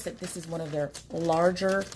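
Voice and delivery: ordinary talk, nothing marked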